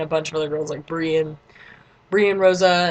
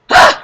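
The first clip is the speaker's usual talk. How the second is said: short loud fangirl gasp-scream; excited freak-out; 16 dB louder than usual. Retold or imitated. Girl turning round to see the performers beside her, mock excited scream. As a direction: burst out in overexcitement. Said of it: "I freaked out".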